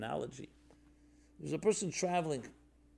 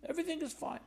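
mocking breezy reassurance; 13 semitones higher, 6 dB quieter, faster than usual. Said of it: "That's like the first option".

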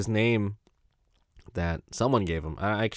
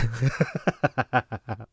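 long helpless cackle; uncontrolled run of laughter; real laughing fit; 2 semitones higher than usual. Real, not acted. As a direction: burst out laughing when you shouldn't. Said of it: "I shouldn't be laughing at that".

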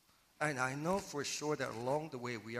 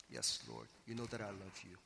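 much lower and softer, hesitant "Yes, Lord"; reluctant quiet answer; 4 semitones lower, 6 dB quieter than usual. Shown as a demonstration. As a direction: hold back, as if ashamed to say it. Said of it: "Peter reluctantly responding".

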